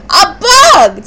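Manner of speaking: high, loud shriek of alarm. A narrator's reading. Tia spots a bug in the sandwich, shrieking. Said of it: "Tia shrieked".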